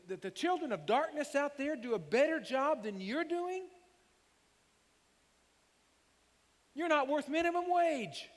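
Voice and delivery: high, loud, slow berating yell of clodheads; hammed-up furious rant. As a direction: rage at lazy disciples, over the top. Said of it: "I wonder how Jesus said this statement".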